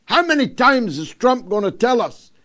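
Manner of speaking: usual speaking voice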